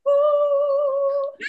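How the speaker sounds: a high, sung whoop of delight; excited woo. Real, not acted. Cheering the two guests joining together, a high sung woo.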